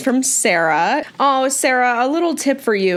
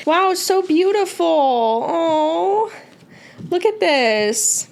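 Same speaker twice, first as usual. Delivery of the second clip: high, slow admiring coo; lifted, lingering oohing over artwork; awed gushing; 8 semitones higher, slower than usual. Real, not acted. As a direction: draw it out in delighted awe. Admiring an art print.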